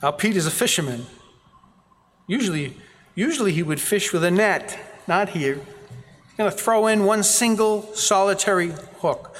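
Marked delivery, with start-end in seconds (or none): none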